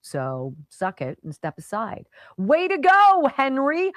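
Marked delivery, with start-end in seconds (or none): 2.4-3.9 loud cheer. Real, not acted. Cheering governor's move.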